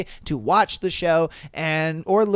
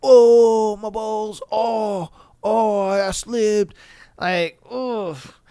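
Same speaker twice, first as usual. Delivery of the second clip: mock pained groan; 3 semitones higher, much slower than usual. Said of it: "He was just like".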